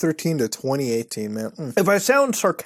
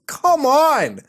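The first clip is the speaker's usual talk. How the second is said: exasperated shout; 9 semitones higher, 3 dB louder than usual. Real, not acted. Reading a cringe hitman story, shouting in exasperation. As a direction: come on shouted high and loud.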